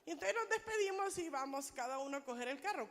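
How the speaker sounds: ordinary delivery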